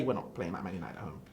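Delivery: grumbled repeat of the correction; muttered repeat. Real, not acted. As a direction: mutter it again under your breath.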